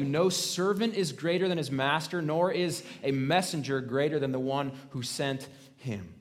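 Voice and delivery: ordinary delivery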